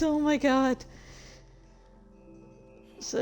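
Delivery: normal talking voice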